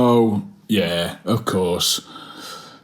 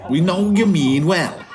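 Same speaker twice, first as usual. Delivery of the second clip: a lightly voiced high mate, pushy; high-pitched mate; 9 semitones higher than usual. Mate nagging Cal about his podcast, high put-on voice. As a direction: nag him in a high put-on voice.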